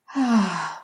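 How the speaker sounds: big audible sigh; loud, falling sigh; heartfelt sigh. Real, not acted. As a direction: sigh out loud, overcome with gratitude. Moved by the blessing.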